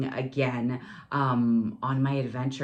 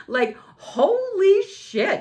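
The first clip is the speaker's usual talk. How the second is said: mock shock; 5 dB louder, much slower than usual. Reacting to her own podcast.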